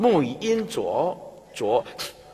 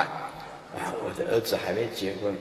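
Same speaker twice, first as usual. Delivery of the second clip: low, quick mumbled worry; a lightly played weak answer; mock dying reply; 6 semitones lower, 7 dB quieter than usual. Dying parent confiding an unmarried son, low mock worry.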